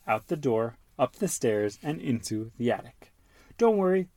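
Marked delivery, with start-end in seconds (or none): 3.6-4.1 high Peppa voice. Acted. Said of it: "says Peppa".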